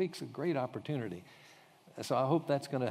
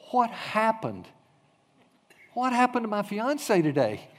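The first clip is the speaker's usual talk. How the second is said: excited questioning; 8 semitones higher, 5 dB louder than usual. Retold or imitated. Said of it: "He is excited".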